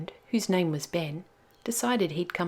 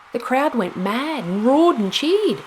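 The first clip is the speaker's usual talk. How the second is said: higher and much louder, cheering; excited climax; 7 semitones higher, 9 dB louder than usual. A narrator's reading. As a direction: shout the win like the crowd roaring. Climax of the boat race.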